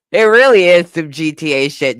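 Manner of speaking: ordinary delivery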